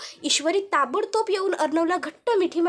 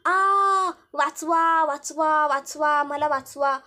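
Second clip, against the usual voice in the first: mock, half-hearted help call; mocking cry for help; slower than usual. Villain mimicking his victim's cries for help, mocking. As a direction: drone a fake plea for rescue.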